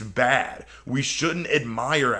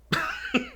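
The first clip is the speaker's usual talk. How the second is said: a quick high burst of disbelieving laughter; short incredulous laugh; 4 dB quieter than usual. Real, not acted. Reacting to Gaston's downfall, a high scoffing laugh. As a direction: laugh it off like the point proves itself.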